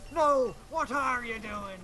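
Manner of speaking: slow, lightly voiced pirate reply; slow pirate retort. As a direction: drag it out like a pirate. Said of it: "He goes".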